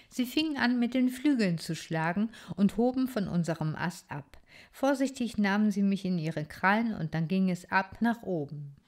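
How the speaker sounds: ordinary delivery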